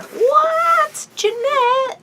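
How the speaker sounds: high, loud squeal of delight; excited shriek. Real, not acted. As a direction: shriek her name in delight. Unboxing gift, sudden excited shriek.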